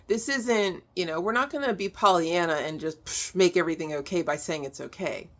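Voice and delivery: usual speaking voice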